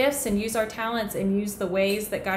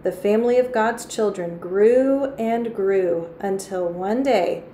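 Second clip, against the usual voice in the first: measured, expressive storytelling with key words lifted; storybook read-aloud; 4 dB louder than usual. A narrator's reading. Teacher reads a Bible story to children, expressive storytelling. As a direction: read it to children, drawing out the drama.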